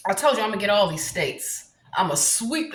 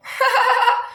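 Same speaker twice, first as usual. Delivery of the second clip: high, rapid burst of cackling; gleeful cackle; 12 semitones higher, 4 dB louder than usual. Real, not acted. Comedian relishing going after Donald, high cackle.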